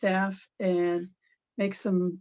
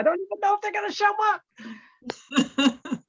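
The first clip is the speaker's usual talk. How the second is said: laughing mock terror; 11 semitones higher, much faster than usual. Real, not acted. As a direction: voice climbing in half-joking panic. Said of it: "now I'm terrified".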